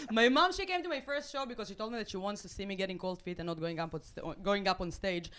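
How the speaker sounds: ordinary talk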